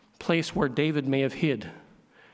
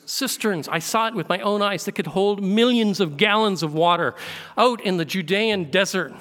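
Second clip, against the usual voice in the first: a lifted, louder preaching voice, insisting it was seen; emphatic preaching; 4 semitones higher, 4 dB louder than usual. Acted. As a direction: preach it as eyewitness testimony. Preacher rising to emphatic testimony.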